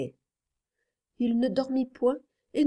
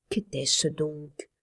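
low, slow and hushed, holding her breath; fearful hush; 4 semitones lower, 4 dB quieter, slower than usual. A narrator's reading. Mother at her son's dark door, a frightened hushed question. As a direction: ask it barely aloud, afraid of the answer.

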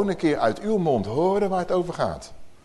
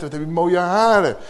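friendly compliment; 2 semitones higher, 4 dB louder than usual. Retold or imitated. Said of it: "Dan zeg ik, joh meid".

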